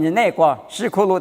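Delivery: usual speaking voice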